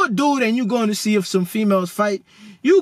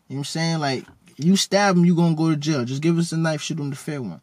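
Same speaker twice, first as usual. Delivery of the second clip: ordinary delivery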